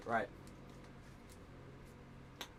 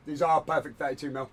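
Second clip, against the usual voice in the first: usual speaking voice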